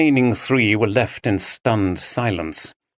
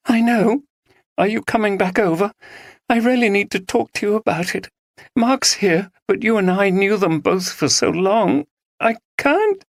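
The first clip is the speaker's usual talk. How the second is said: breaking down; 11 semitones higher than usual. Acted. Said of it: "She broke down in tears".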